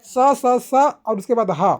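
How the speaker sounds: सा and हा called out loud, drawn from the breath; demo syllables. Shown as a demonstration. Teacher voicing सा and हा for students, loud. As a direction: sound out सा, सा, सा then हा, loud and breathy. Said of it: "और उसके साथ जो हा है".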